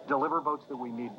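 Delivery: "need" given deliberate, pointed weight; stress on need. Shown as a demonstration. To Craig about courting LaFleur's votes, weight on "need". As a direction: lean hard on "need" so he gets the hint. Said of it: "Notice my deliberate emphasis on the word need".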